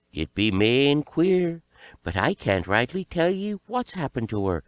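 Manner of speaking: high-voiced, puzzled village wise woman. A narrator's reading. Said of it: "a lot of them went to the wise woman".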